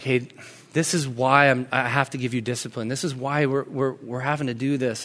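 low, soft, patient parent voice; retold gentle explanation to a child; softly explaining. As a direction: explain it quietly and kindly. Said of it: "I just began to softly explain".

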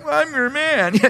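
"I'm your man" high-pitched, at normal volume; added emphasis. Shown as a demonstration. Showing the added emphasis on "I'm your man", emphatic. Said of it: "I added that emphasis of like".

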